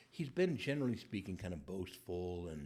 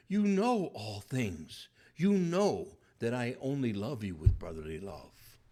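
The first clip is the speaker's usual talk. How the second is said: louder and slow, stung and defensive; hurt, taunting reply; 8 dB louder, slower than usual. Acted. Peter answering Jesus the third time, loud and stung. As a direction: throw it back at Jesus, wounded. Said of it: "supplying a tone".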